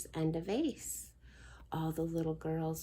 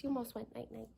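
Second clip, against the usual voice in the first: hushed; 4 semitones higher, 9 dB quieter, much faster than usual. Real, not acted. Baby nearly falling asleep.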